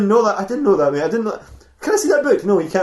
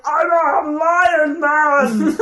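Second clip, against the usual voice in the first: high, very slow agonized wail; hammed-up beast howling in pain; creature in pain; 7 semitones higher, much slower than usual. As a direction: drag it out like a monster in agony. Creature announces itself.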